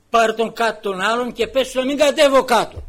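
loud, high angry order; angry shout. Retold or imitated. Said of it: "Φωνάζει".

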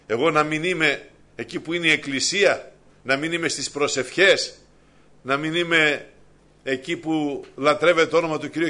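loud, high preaching at full force; preacher at full voice warning against pride; fiery preaching. Acted. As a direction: rouse the congregation. Rising exhortation in sermon.

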